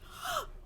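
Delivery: sudden high shocked gasp; sharp gasp. Real, not acted. Reacting to Joyce on the floor, horrified gasp. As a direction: suck in air in horror. Sees Joyce lying on screen.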